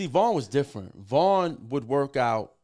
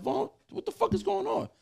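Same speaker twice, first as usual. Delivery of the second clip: much higher, alarmed retold cry; alarmed question. Seeing his friend in a hospital bed, high shocked question. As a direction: shoot the pitch up in shock.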